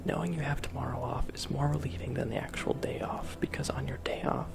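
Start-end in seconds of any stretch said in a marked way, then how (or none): none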